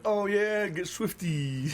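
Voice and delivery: high, then slow character quote; cartoon character voice. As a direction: ham up the cartoon catchphrase. Quoting cartoon catchphrase.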